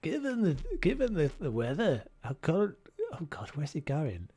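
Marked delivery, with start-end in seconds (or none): 0.0-2.8 put-on high-swooping Scottish accent. Gamer tuning it back in, exaggerated Scottish accent. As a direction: attempt a lilting Scottish accent. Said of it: "Tune your Scots".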